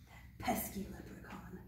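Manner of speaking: soft grumbling whisper; hushed playful whisper. Real, not acted. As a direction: whisper it in mock annoyance. Whispered aside.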